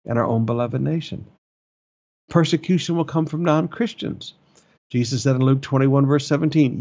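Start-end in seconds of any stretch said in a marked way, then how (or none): none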